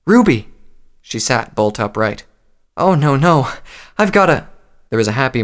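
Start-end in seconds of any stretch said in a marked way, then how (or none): 0.1-0.5 high, loud startled exclamation; alarmed realization. A narrator's reading. Mother suddenly remembers her daughter on waking, alarmed. 2.8-4.5 raised, louder flustered exclamation; panicked. A narrator's reading. Mother waking in a strange bed remembers Ruby, panicked.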